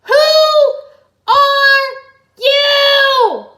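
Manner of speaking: very high, very loud shout; Jay shouting up at Samurai X; demanding shout. A narrator's reading. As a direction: bellow the question. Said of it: "Hey, hey, I got this".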